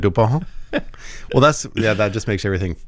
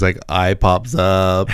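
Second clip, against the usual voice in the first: usual speaking voice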